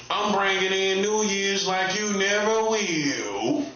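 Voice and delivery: slow, taunting drawl with NEVER lifted; slow drawn-out boast. Acted. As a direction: stretch every word slowly, savoring it. Repeats boast, second take drawn.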